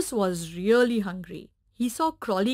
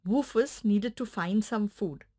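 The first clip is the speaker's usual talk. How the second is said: plain reading voice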